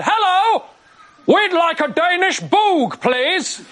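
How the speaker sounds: high, drawn-out, over-confident customer; confident dad. Retold or imitated. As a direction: imitate a dad ordering with total assurance. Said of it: "if anything, increased confidence".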